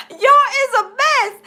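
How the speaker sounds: barely getting the words out for laughing; cracking up; laugh-crying. Real, not acted. Laughing hard at the chat.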